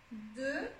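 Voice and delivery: "deux" said softly alone with a rounded eu; E sound demo. Shown as a demonstration. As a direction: isolate "deux" to show its eu vowel. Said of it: "On a aussi le E".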